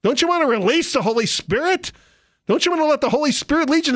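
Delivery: high, pressing, fast pleading; mock worship leader plea. Preacher mimics a worship leader urging the congregation, high and fast. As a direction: urge the crowd like a pushy leader.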